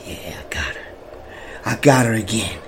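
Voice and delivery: quiet, whispered self-satisfied gloat. Retold or imitated. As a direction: whisper to yourself, pleased. Secret gloating to himself on phone.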